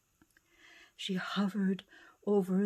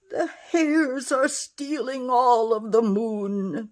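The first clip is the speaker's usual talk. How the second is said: frustrated whimper; 4 semitones higher, 8 dB louder than usual. Acted. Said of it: "the bears whimpered in frustration".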